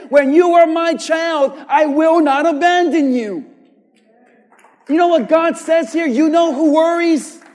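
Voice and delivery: loud, rising sermon delivery with hammered words; preaching at full force. Acted. Preacher rousing the church about God's word, loud and fervent. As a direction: preach it, voice climbing and punching.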